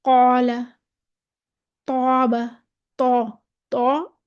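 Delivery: "कौला, तौबा, तौ" with a heavy, rounded long alif; मोटा अलिफ. Shown as a demonstration. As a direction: say "कौला, तौबा, तौ" with full, dark vowels. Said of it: "हम इस अलिफ को मोटा पढ़ेंगे". ("कौला, तौबा, तौ" का अलिफ मोटा करके पढ़ें)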